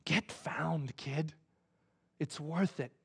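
low, very soft voice; hushed, earnest encouragement; hushed whispering appeal. Retold or imitated. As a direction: speak tenderly to someone hiding. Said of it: "God's whispering to you".

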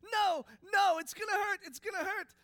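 put-on high, loud, frantic child's protest; panicked refusal. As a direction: cry out against it in terror. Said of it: "She goes".

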